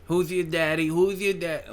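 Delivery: high-pitched mock boxer; Mike Tyson. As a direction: demand it in a high impression voice. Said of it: "Mike Tyson wouldn't even be a good porn actor".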